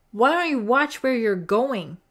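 loud, high angry cry; sharp startled shout; cried out. A narrator's reading. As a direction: yell it at the girl who bumped you. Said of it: "cried Jerry".

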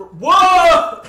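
loud, high, slow whoa; sudden real surprise; surprised shout. Real, not acted. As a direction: cut yourself off in shock.

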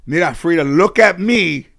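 loud, high, insistent grandmother voice; grandmother's instructions. Retold or imitated. Grandmother coaching mom before court, loud and insistent. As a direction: drill it in like a bossy grandmother. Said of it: "My grandmother said".

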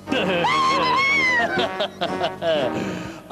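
high, drawn-out evil cackle; villainous vampire laugh. Acted. Vampire Count bursting in on the children, evil laugh.

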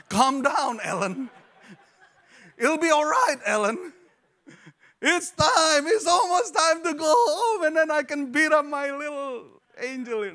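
high, loud, laughing frantic mother; hammed-up frazzled mom yelling through laughter; exasperated mock yell. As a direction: shriek it like a mom at her breaking point. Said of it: "No, just kidding".

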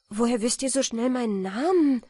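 ask, taken aback; dismayed surprise. A narrator's reading. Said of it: "sah sie bestürzt an".

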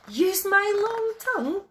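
high, loud, playful reply; cheeky little bear's delighted answer; gleeful answer. A narrator's reading. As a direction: answer high and proud with glee. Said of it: "laughed Little Bear".

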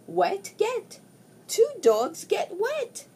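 ordinary delivery

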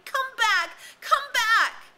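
high, loud, crazed screaming; frantic scream. A narrator's reading. Ralph screaming at the ship, frantic and unhinged. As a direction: let the voice break into madness. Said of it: "his voice rose insanely".

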